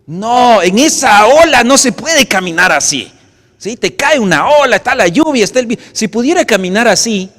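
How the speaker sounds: fervent preaching. Acted. Preacher pictures the storm.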